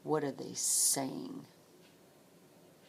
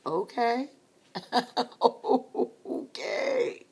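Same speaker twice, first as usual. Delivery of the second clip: amused laugh; 10 semitones higher, 8 dB louder, slower than usual. Retold or imitated. Reacting to smash-the-house request.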